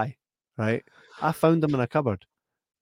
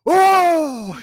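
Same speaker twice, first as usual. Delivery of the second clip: high, loud startled "Oh!"; shocked gasp; 10 dB louder than usual. Retold or imitated. Friend in car smells stink bomb, shocked cry.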